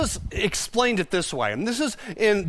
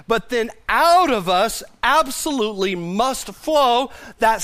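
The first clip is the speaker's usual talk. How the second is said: fervent preaching; 5 semitones higher, 5 dB louder than usual. Acted. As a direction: preach it with building fire. Preaching at full force.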